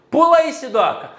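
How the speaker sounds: a friend daring the speaker to jump the waterfall, teasing; mock dare. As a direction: egg him on to jump. Imagined friend daring him to jump.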